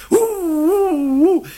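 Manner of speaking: high, long mock howling; wolf howl. Storyteller answers as the wolves, high drawn-out howl. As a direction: howl like a pack far away.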